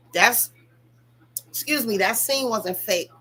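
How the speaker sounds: ordinary talk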